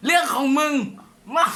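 loud mocking reply to a chat comment; loud retort. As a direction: punch it out high and loud. Streamer reads a rude comment aloud.

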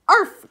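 loud, high imitated bark; short sharp arf; dog yelp. A narrator's reading. Doctor Livingston bumping the glass, yelping. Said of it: "cried Doctor Livingston".

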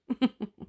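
short teasing laugh; mocking chuckle. A narrator's reading. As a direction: chuckle at the little brother. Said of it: "his brothers were laughing out loud".

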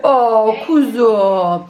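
very slow laughing coo; slow tender pet coo with a laugh. Real, not acted. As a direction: draw it out, melting over the dog. Greeting the dog tenderly.

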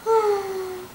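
sharp little gasp of surprise; shocked gasp. Acted. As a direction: gasp as your jaw drops. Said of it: "Poodlina's jaws dropped".

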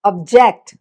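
Object with ജക്റ്റ് hit; object, weight on ജക്റ്റ്; stress on JECT. Shown as a demonstration. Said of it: "അവിടെ ജക്റ്റിനാണ് ആ stress".

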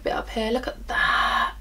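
slow, breath-only amazement; awed whisper. Real, not acted. Vlogger marvelling at her highlighter, awed whisper. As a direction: whisper it softly in wonder.

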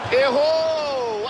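excited call. Acted. Missed shot call.

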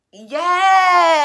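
loud cry of triumph; joyful shout. Real, not acted. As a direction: shout it with pure relief. Discovers she can taste again.

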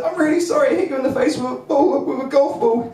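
high, flustered apology; mildly high, fretful sorry; apologising. Retold or imitated. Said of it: "And I said".

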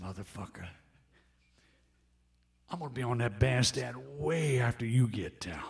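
low, breathless whispered threat; imitating an old bluesman's hushed boast; menacing whisper. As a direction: whisper it like a cocky warning. [name]'s cocky threat.

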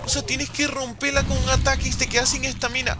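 high, loud exasperated complaint; outraged ranting. Real, not acted. Gamer complaining about the blocked path, outraged ranting. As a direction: protest loudly at the unfair trick.

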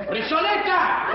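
high, loud call to an animal. Acted. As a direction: call the cow from afar. Llama a la vaca. (llamando a gritos)

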